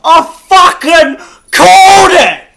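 very high, loud triumphant shouting; ecstatic yelling. Real, not acted. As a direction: scream with disbelief and joy. Called the drop, yelling.

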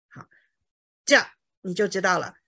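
normal talking voice

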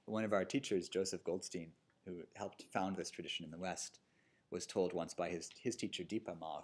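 normal talking voice

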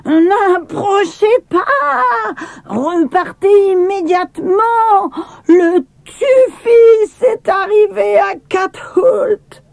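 very high, slow, carrying shout; piercing cry. A narrator's reading. Maya warns the arriving guests away, piercing slow shout. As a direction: warn them off from the top of the stairs. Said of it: "elle leur cria d'une voix perçante".

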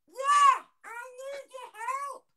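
very high squealed plea; yelp, then drag out the plea, squeakily; baby voice. Acted. Hidden prank child begging bloke, squealing baby voice. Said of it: "The, the baby voice".

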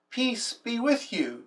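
slow, soft and tender; a gentle slow blessing from Jesus; gentle peace greeting. Acted. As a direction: bless them quietly and slowly. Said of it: "Jesus said".

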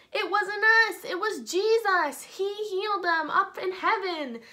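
earnest preachers. Acted. Peter and John telling the crowd, loud earnest proclaiming. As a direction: announce it was Jesus. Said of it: "Peter and John are like".

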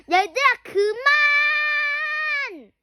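high, slow desperate shout. Acted. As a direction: yell at the kids to stop. Shouting to stop fight.

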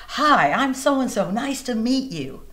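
high, slow, friendly hello; voicing a friendly local; friendly greeting. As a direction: greet a stranger warmly.

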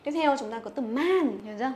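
normal talking voice

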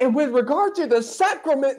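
high, hammed-up solemn announcement; mock solemn Cranmer. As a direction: mimic the archbishop's grand opening line. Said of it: "he's like".

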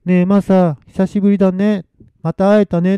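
put-on high, sweet ghostly girl voice; Noriko. Dead Noriko greeting Masa through the god, sugary. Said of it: "もうのりこの声で".

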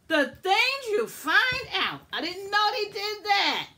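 high, loud, thrilled exclamations; real delighted shrieking surprise; excited loud squeal. Real, not acted. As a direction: gush over the discovery at full volume. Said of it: "I'm talking so loud".

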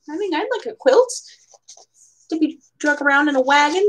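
usual speaking voice

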